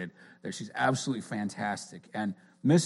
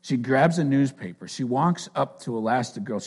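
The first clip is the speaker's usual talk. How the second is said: usual speaking voice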